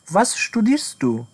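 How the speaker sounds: each word drawn out for learners; a careful, spelled-out question; slow model question. Shown as a demonstration. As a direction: say it slowly and clearly, like a teacher. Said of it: "full model question, slowed".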